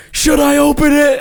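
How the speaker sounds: high, pleading showman's call; crowd-baiting wrestler. Retold or imitated.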